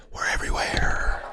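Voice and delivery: breathy hushed whisper. Pretend. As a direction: drop to a secret whisper. Playful spooky intro whisper.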